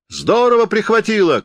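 high-pitched call from the back; shouted heckle. A narrator's reading. Spectator at the back heckles the freezing actor, calling out. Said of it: "кричали сзади".

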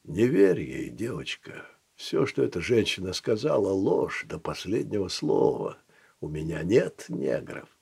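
low, soft, languid seducer; husky Don Juan voice. Acted. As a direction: croon it like an old charmer. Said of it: "хрипловатым и томным голосом бывалого Дон Жуана".

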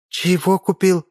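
baffled by his purchase; surprised. Acted. The beauty asking Misha what he bought, surprised. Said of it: "удивилась красавица".

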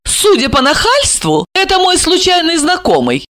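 high, scornful put-down; contemptuous. A narrator's reading. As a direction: sneer at the cheeky stranger. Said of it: "с презрением произнесла она". (с презрением)

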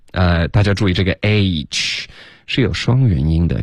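usual speaking voice

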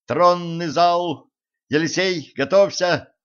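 high, urgent narrated yell. A narrator's reading. Zhenka directs carpet and Elisei, yelling. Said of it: "заорала девушка".

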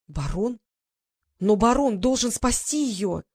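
mild desperate shout; shouting again. A narrator's reading. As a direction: plead loudly and slowly. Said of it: "закричала она опять".

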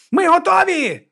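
high-pitched call out; shouting. A narrator's reading. Viga turning to call the others, shouting. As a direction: yell it across the room, high. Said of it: "розвернулася і крикнула".

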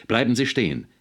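lauter, hoher Befehlsruf; keuchend drohen. A narrator's reading. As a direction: ihn scharf aufhalten. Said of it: "keuchte Potterly". (aufgebracht gerufen)

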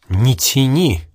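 mildly angry, impatient command; a touch of annoyance; growing irritation. A narrator's reading. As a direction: urge them on, starting to lose patience. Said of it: "Он начал злиться".

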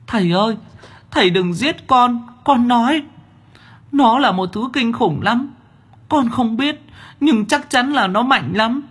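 put-on very high, desperate child-demon plea; begging demon child. A narrator's reading. Demon child begs the master not to kill it. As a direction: beg for its life.

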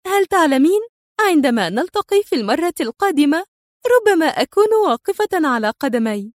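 high, put-on merriment; artificially upbeat; feigned cheerfulness. A narrator's reading. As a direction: pitch it up in forced cheer. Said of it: "تقول في مرح مصطنع".

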